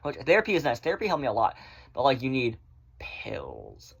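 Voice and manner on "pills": breathed ominous whisper; mock whisper. Vlogger mocking doctors pushing pills, whispered. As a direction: whisper the word like a dark secret.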